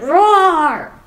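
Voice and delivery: loud, drawn-out pretend roar; monster roar. A narrator's reading. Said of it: "big monster who went".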